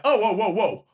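high-pitched mock objection; interrupting judge. Judge stopping Nicolas Cage's testimony, mock interrupting. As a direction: cut in like a fussy judge. Imitates judge cutting witness off.

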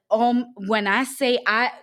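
ordinary delivery

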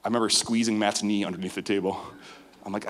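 normal talking voice

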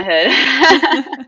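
slow, laughing and proud. Real, not acted. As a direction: savor it through a laugh. Proudly claiming her neighborhood.

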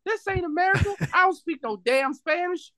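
very high-pitched imitation of outraged screaming; mock screaming. Imitating three ladies screaming down the street, mock outraged scream. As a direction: squawk it way up high, mimicking indignant tourists. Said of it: "they're screaming down the street like".

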